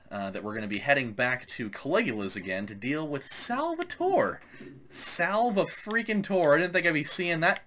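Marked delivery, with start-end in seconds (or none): 4.9-6.6 slow, stressed Salva-freaking-Tor; exasperated slow naming; drawn-out name. Real, not acted. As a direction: lean on each part of Salva-freaking-Tor in disgust. Spits out villain's name.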